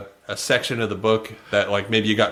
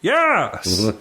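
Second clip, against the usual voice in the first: mock [name] yes; 4 semitones higher than usual. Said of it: "except for".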